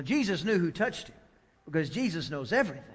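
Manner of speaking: usual speaking voice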